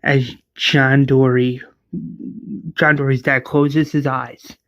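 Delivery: normal talking voice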